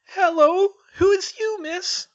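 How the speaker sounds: very high, loud trembling elf squeak; timid house-elf greeting a stranger, quivering squeak. Acted. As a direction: squeak it out, small and nervous. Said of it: "spoke with a quivering squeak".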